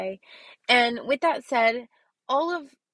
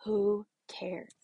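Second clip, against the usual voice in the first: whispered; 2 semitones lower, 4 dB quieter than usual. Real, not acted. Dismissive point, whispered.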